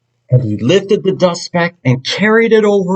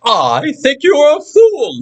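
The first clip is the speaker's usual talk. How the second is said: laughing kangaroo; 12 semitones higher than usual. Acted. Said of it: "laughed the sour kangaroo".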